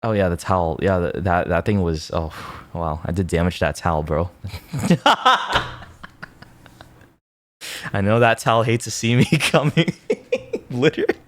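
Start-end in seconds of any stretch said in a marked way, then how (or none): 4.4-6.2 a sudden high burst of real laughter. Real, not acted. Vlogger laughing at his own towel joke, high laugh. 7.9-9.9 words broken up by real laughter; laughing through words. Real, not acted. Vlogger joking about the towel to viewers, laughing while talking. 10.0-10.6 a short, very high real laugh; high squeaky laugh. Real, not acted. Vlogger still cracking up at his towel joke, squeaky laugh.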